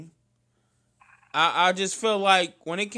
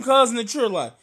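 normal talking voice

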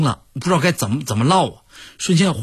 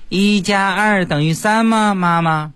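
put-on high, drawn-out little-kid voice; a small child slowly asking mum; 8 semitones higher, 3 dB louder, slower than usual. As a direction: stretch it out high like a kindergartner. Child asks mum during homework.

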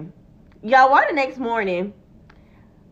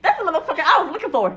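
quick, higher mock threat with laughter; imitating angry friend; 5 semitones higher, much faster than usual. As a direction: rattle off the friend's line, amused. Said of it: "You see how I say that shit".

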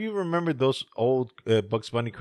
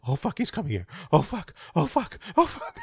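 panicky thoughts; 8 semitones higher than usual. Retold or imitated. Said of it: "all those thoughts are just coming".

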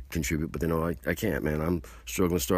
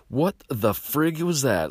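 stunned customers; 9 semitones higher, 5 dB louder, much slower than usual. Retold or imitated. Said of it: "was like".

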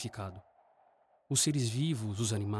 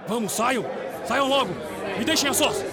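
angry shout; 11 semitones higher, 5 dB louder than usual. Acted. Captain orders crew out.

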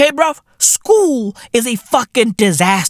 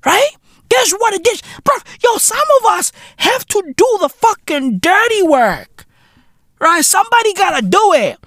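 high comic yelling; squeaky mock shout; 10 semitones higher than usual. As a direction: shriek it like a cartoon tough guy. Mock boast, ranting about blue collar.

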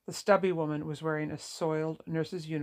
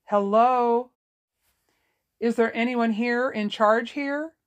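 raised, higher voice calling for help; 6 semitones higher, 8 dB louder, slower than usual. A narrator's reading. Lauren looking for someone in charge at the nursing home, calling out. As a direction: call down an empty hallway, loud and questioning. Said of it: "she called out".

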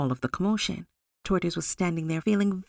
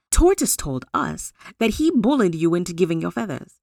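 usual speaking voice

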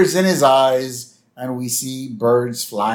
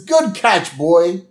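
high, upbeat praise; acted pleased cartoon exclamation; praising a dog; 6 semitones higher, 3 dB louder, slower than usual. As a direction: cheer on your dog. Bart praising his dog.

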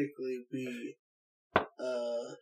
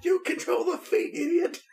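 high, louder insult at his twin; giant snapping; 13 semitones higher, 3 dB louder than usual. Acted. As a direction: snap at the other head. Acting the giant's quarrel.

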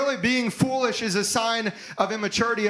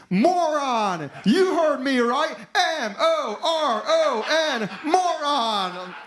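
fiery preacher imitation; 5 semitones higher, slower than usual. Radio preacher yelling at listeners, mock fiery laughing shout. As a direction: spell it out with a laugh, hammed up. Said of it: "Brother Donnie Swaggart saying".

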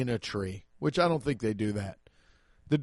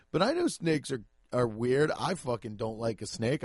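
normal talking voice

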